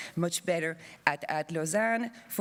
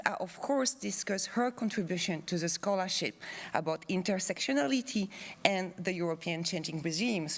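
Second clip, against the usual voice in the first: usual speaking voice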